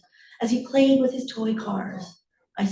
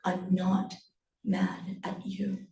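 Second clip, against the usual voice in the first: soft, slow, breaking into near-whisper; quiet words with a pause after "not"; hushed reassurance; 7 dB quieter, slower than usual. Acted. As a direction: murmur it slowly to reassure. Mother repeats softly, before angry turn.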